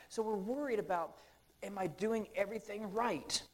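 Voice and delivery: fired-up preacher; preaching at full force. Acted. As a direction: drive the questions home to the congregation.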